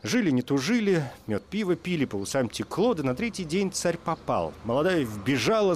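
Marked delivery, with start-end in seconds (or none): none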